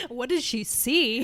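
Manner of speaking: slow, laughing question. Real, not acted. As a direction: ask it through laughter. Listener laughing at story.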